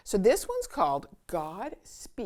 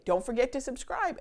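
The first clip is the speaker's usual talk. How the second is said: ordinary delivery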